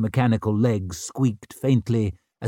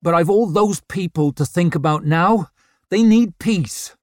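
louder, higher, words snapped out; angry snap; 8 semitones higher, 6 dB louder than usual. A narrator's reading. Rika defending her choice for her people, angry snap. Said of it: "then snapped the words angrily".

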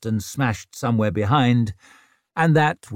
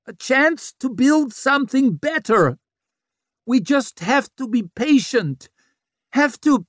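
voice cracking; 13 semitones higher than usual. A narrator's reading. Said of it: "her voice cracking".

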